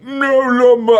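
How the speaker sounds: high, loud garbled yelp; mimicking dazed friend. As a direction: imitate a friend blurting out mid-high. Mimics friend reeling on inhalant.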